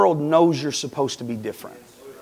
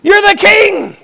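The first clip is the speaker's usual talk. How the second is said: high shriek of alarm, acted; loud cry; 13 semitones higher, 7 dB louder, slower than usual. Witch realizing her visitor is the king, loud cry. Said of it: "She cried with a loud voice".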